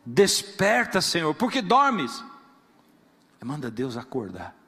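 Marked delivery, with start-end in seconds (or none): 0.0-2.2 loud, slow call to wake God; loud slow plea to God; pleading cry. Acted. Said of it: "sabe o que ele fala".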